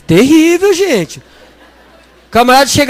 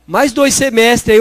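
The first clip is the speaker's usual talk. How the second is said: ordinary delivery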